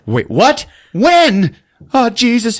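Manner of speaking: hammed-up shock at bad news; mock alarm. Man hearing news on the phone, mock shock. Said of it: "He's like".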